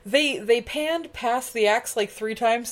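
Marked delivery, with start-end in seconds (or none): none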